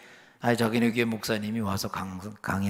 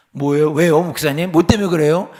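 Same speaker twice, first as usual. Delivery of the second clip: loud, higher-pitched startled questions; startled protest; 5 semitones higher, 12 dB louder than usual. Retold or imitated. Young member protests to the pastor, loud and taken aback. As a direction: fire back questions in loud mock alarm.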